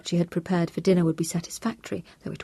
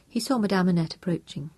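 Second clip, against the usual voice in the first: ordinary delivery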